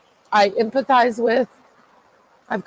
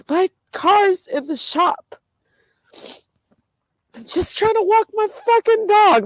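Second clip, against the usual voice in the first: crying; 10 semitones higher than usual. Real, not acted. Lamenting the car and the dog walk, crying. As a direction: plead through tears, voice pitched high. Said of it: "'cause I'm crying".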